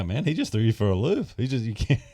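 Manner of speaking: ordinary delivery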